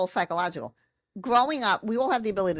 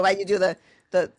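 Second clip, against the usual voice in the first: normal talking voice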